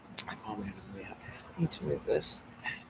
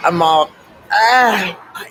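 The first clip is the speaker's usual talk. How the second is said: shocked shout; 6 semitones higher, 13 dB louder, much slower than usual. Real, not acted. Shocked outburst, laugh shout.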